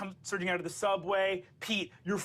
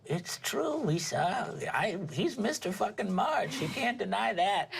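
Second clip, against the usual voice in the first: grandmother voice; 3 semitones higher, slower than usual. Acted. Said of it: "her grandmother says".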